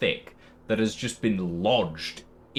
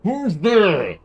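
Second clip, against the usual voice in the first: loud, muffled call through a door; muffled gruff villager; 9 semitones higher, 9 dB louder than usual. Acted. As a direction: bark it from behind the door.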